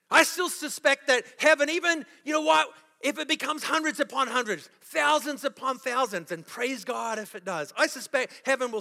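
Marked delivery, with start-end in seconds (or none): none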